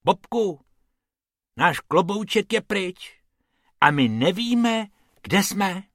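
a small bunny wondering where they are; worried rabbit. Acted. Bob tells Bobek the hat is gone, high.

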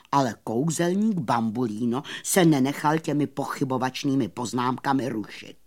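ordinary delivery, nothing marked

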